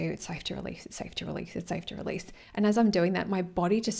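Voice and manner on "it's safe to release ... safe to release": softer, quiet repeated mantra; calm yourself gently; soft self-soothing. Retold or imitated. Repeating a mantra to herself while shaking, soft and quiet. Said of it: "I was like".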